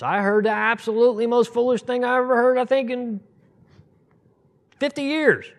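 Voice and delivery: high, loud disbelief; rising astonished exclamation; incredulous outburst. Real, not acted. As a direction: build to a high incredulous peak.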